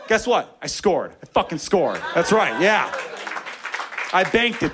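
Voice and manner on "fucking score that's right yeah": ordinary talk, nothing marked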